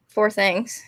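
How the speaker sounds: ordinary talk, nothing marked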